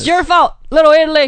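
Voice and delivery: mock Italian voice. Teasing friend in mock accent.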